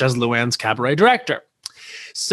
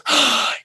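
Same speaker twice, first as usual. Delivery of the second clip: mock gasp; 12 semitones higher than usual. [name] reacting with recognition.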